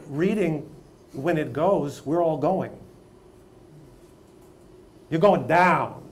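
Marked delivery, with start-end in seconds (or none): 5.1-6.0 loud, punched warning, pitched up; emphatic warning. Acted. Teacher warning non-readers in the class, loud and emphatic. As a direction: call it out forcefully.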